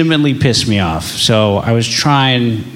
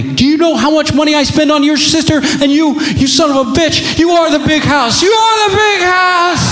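much higher, shouted mock rage at his son; furious dad rant. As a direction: scream it like a dad losing it. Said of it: "That's what I was feeling".

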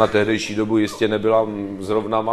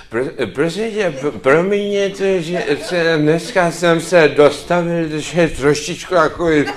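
put-on higher, slow, stumbling drunk voice; opilý herec; 4 semitones higher, 4 dB louder, slower than usual. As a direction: play a tipsy actor struggling through an apology. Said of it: "že herci pijou". (opilecky se zakoktávat)

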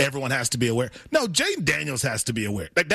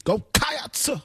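high, ringing "Go, go Cats!" like a Southern preacher; preacher-like cheer; 11 semitones higher, slower than usual. Shown as a demonstration. Co-host retries the cheer, sounding like a preacher. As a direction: holler it from the pulpit.

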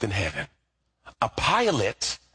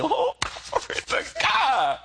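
"Oh, glory to God" pitched much higher, slow and drawn out; excited praise; slower than usual. Acted. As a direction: exult like a preacher who just saw his mansion. Preacher delighted in heaven.

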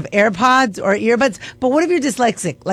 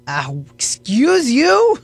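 mock indignant; 4 semitones higher, slower than usual. Indignant reply to article.

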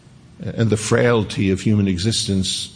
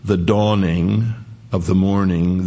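slow modern rendering; slower than usual. Shown as a demonstration. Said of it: "we would translate it today".

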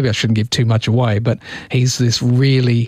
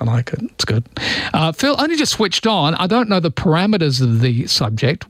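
ordinary delivery

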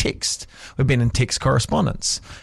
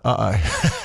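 dawning uh-oh; 8 semitones lower than usual. Retold or imitated. Realizing the autocorrect mistake.

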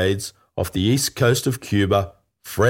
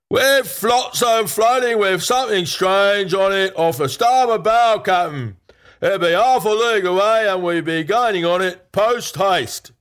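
high-pitched sailor calling out from the masthead; lookout's cry; 11 semitones higher than usual. A narrator's reading. Lookout hailing the Captain from the topmast, high-pitched cry. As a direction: sing out in a high, carrying lookout voice. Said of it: "a cry came from the topmast".

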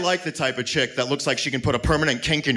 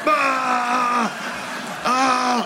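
long, high held "ah"; mock holler; 10 semitones higher than usual. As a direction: holler as if being flipped upside down. Mimes being flipped upside down.

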